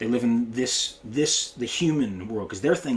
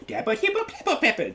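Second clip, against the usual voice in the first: much higher, laughing scat of busy licks; mock guitar licks; 13 semitones higher than usual. Shown as a demonstration. Mocking flashy high banjo licks.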